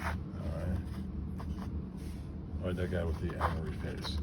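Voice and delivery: normal talking voice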